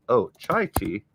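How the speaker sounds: usual speaking voice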